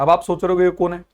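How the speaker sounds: ordinary talk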